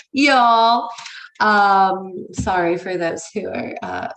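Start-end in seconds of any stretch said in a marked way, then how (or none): 0.1-0.9 Southern y'all. Retold or imitated. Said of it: "It's not like".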